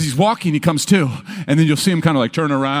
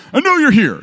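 mock irritated reply; 11 semitones higher, 6 dB louder than usual. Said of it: "I don't wanna be like".

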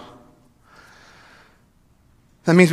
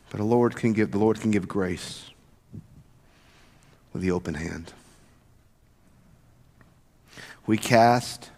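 soft closing hush; 5 semitones lower, 6 dB quieter than usual. Acted. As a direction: lay it down gently for them.

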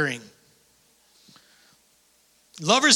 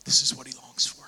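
hushed whisper; 6 semitones lower, 5 dB quieter than usual. Real, not acted. Hushed after shouting.

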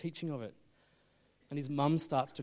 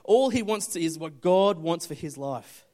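loud, raised-pitch sermon delivery; impassioned preaching; 7 semitones higher, 13 dB louder than usual. Real, not acted. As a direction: preach it out at full force. Preaches at full force.

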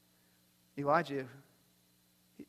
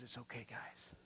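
soft, gentle reassurance, much quieter; tender comfort; 7 semitones lower, 16 dB quieter than usual. Acted. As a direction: hush them kindly like frightened friends. Jesus comforting frightened disciples.

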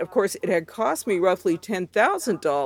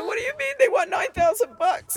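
wailing protest. Retold or imitated. Crying daughter protests to mom.

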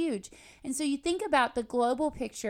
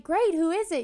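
higher, louder mock excitement; eager retold question; 6 semitones higher, 6 dB louder than usual. Young couple asks father-in-law about new pastors, imitated eagerness. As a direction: imitate an eager, unsuspecting reply.

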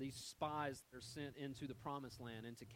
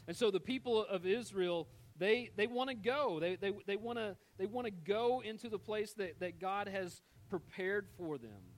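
higher and louder, rushing with eagerness; eager excitement; 5 semitones higher, 7 dB louder than usual. A narrator's reading. Preacher telling how eager Israel was to go, higher and louder. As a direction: sell how badly they want to go.

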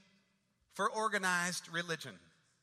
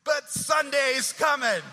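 loud, higher answering call; congregation's loud reply; 5 semitones higher, 12 dB louder than usual. Retold or imitated. Congregation answering the pastor's sermon with a loud call. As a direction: shout back as the whole congregation. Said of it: "And the congregation said".

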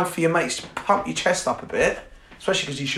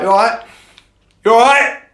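louder, higher call of you all right; shouting a greeting over club noise; a brash mock call across the bar; calling out to a girl; 8 semitones higher, 10 dB louder than usual. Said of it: "they'd be like".

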